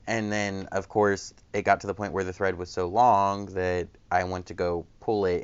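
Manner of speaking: ordinary talk, nothing marked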